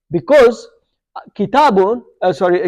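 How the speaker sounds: ordinary talk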